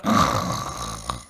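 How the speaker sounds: low fake snore; mock snore. Sister teasing her boring talk, mock snore. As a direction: pretend to nod off with a snore. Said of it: "she'll just start snoring".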